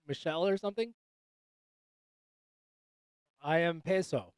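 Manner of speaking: normal talking voice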